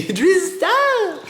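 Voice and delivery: very high, loud playful protest; giggly squeal. Real, not acted. Woman tickled by her dog, squealing high and loud.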